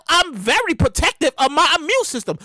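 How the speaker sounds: ordinary delivery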